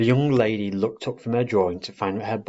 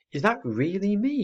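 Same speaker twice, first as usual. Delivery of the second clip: higher, wondering owlet; a hint of amazement; 12 semitones higher than usual. A narrator's reading. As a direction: marvel at your own picture.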